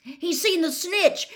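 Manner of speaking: high, loud, drawn-out cry; thrilled spectator yell; excited shout. A narrator's reading. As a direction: shout the sighting over a crowd. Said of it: "Harry shouted".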